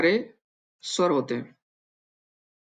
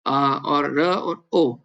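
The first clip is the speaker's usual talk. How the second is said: slow demo syllables; 5 semitones lower, much slower than usual. Shown as a demonstration. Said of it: "अगर हम ओ लाएंगे रूसी भाषा का".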